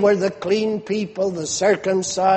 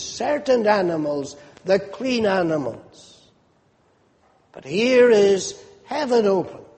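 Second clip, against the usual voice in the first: normal talking voice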